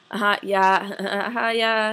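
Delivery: imitated uneasy going-along with a small laugh; awkward agreeing. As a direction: nod along politely, laughing a little. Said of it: "don't know what to say 'cause you're really weirded".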